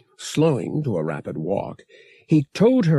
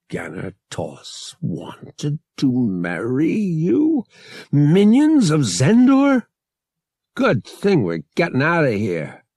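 slow, then higher and louder in disbelief; shocked; 6 semitones higher, 5 dB louder than usual. A narrator's reading. Han hearing about the marriage, shocked disbelief. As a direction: react with stunned disbelief.

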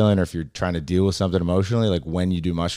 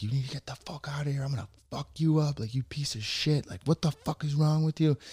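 higher-pitched mock rage, held back in volume; retold outburst; 8 semitones higher, 7 dB quieter than usual. Comic retells threatening the glass-throwing heckler, mock fury. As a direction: replay the blow-up at the heckler, restrained. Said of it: "So that's when I just snapped".

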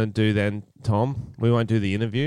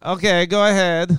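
put-on Borat accent; 8 semitones higher, 4 dB louder than usual. Still in Borat voice.

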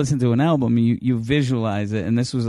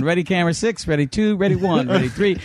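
higher-pitched run of camera cues; director calling cues; 6 semitones higher than usual. Retold or imitated. Director to six cameras, urgent higher-pitched cueing. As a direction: fire off cues from the control booth.

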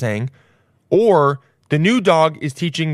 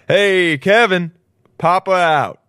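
mock loud sign-off; 5 semitones higher, 6 dB louder, much slower than usual. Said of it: "I'm like".

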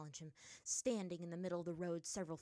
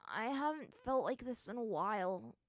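higher, groggy moan; moaned mutter; 4 semitones higher, 4 dB louder than usual. A narrator's reading. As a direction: moan it, drained after collapsing. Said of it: "Aang muttered".

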